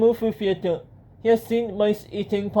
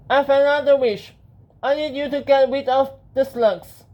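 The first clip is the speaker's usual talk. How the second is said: higher-pitched hero voice; Benjamin; 5 semitones higher, 3 dB louder than usual. Acted. Hero asking the genie to remove slugs, high character voice. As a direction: raise the pitch for an earnest request.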